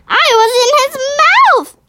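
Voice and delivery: put-on high, loud little girl voice; excited child; small girl Sophie. A narrator's reading. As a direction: Sophie blurting out her news. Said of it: "Sophie said".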